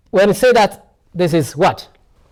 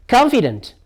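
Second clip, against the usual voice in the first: "Confident" with a high peak, then a fall; "Confident" as a teacher's stress example; stressed-syllable demo; 5 semitones higher than usual. Shown as a demonstration. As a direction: punch the stress in "Confident", pitched up. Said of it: "Where does the stress lie on here now?".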